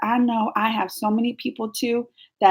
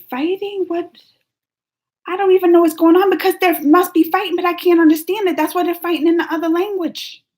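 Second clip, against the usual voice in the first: flustered; 8 semitones higher, 3 dB louder than usual. Real, not acted. Confused by a chat fight.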